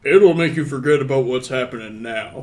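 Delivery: loud bartender punchline then laugh. Acted. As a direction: land the joke and crack up. Bartender's joke, then a big laugh.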